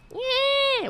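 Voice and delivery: high-pitched screechy mimicry of yelling; mock yelling. Host mimics a politician yelling in a picture, mock screech. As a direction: screech it out as a wordless mocking yell. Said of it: "what is she fucking yelling about".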